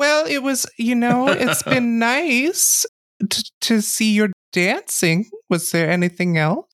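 high, airy noblewoman voice; high polite courtly lady; lady NPC. Acted.

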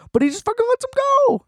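squeaky, very high and fast burst of disbelief; venting at the movie, mocking a villain who just lets them go, in a squeaky high rush. Mocking the plot's easy escape.

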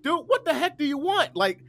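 high-pitched, exasperated mock yell; retold yelling. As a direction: yell at the cop in the retelling, voice shooting up. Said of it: "And so I'm yelling".

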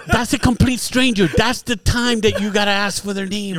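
mock scolding. Friend lecturing a friend on names.

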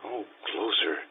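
low and soft, drawing her in close; soft seductive murmur. Acted. Giacomo coaxing Anna closer, hushed and intimate. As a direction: coax her closer, barely above a breath.

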